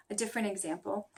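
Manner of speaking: ordinary talk, nothing marked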